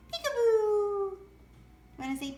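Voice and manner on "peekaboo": slow, louder sing-song peekaboo; playful drawn-out peekaboo; peekaboo demo. Shown as a demonstration. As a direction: draw out the Peekaboo to coax the pet. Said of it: "Do you wanna do a peekaboo?".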